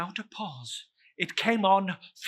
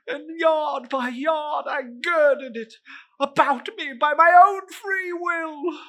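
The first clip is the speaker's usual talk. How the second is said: wailing ghost; 10 semitones higher, 7 dB louder than usual. Acted. Marley's ghost wails about his chain, high and loud. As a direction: cry out the burden of the chain.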